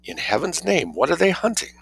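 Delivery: breathless alarmed gasp; high, alarmed gasp. A narrator's reading. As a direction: gasp it out in fright. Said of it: "added in a gasp".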